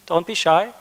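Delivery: ordinary talk, nothing marked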